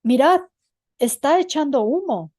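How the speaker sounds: storyteller's startled call; sudden exclamation. A narrator's reading. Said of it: "exclamó de pronto".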